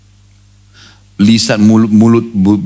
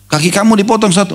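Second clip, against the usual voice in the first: quick high sheikh question; 11 semitones higher, faster than usual. Retold or imitated.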